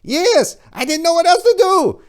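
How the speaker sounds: high, loud frightened wail; panicked woman. Acted. Woman defending throwing the wine, panicked high cry.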